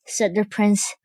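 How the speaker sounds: ordinary delivery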